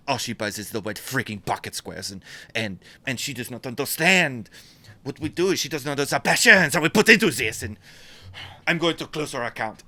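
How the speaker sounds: put-on angry French accent. Seething maker rants about a buyer, angry French accent. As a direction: work up into indignation in bad French. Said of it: "because he's so mad".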